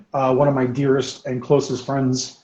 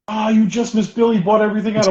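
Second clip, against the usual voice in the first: higher-pitched imitation of a store clerk; acted store clerk; 8 semitones higher than usual. Store clerk telling a regular he missed out, acted apologetic. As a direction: mimic the clerk breaking bad news. Store guy voiced in a story.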